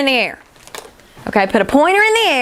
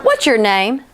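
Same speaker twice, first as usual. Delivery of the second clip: ordinary talk, nothing marked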